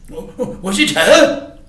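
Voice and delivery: eager question. Acted. Raj rushing out eagerly.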